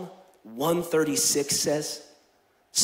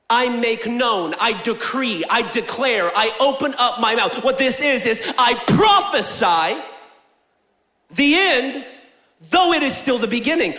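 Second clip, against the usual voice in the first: slow, rising prophetic decree; preaching declaration; 3 semitones higher than usual. Acted. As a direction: proclaim each line like a decree.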